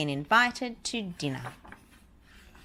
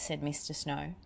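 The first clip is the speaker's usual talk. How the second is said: ordinary delivery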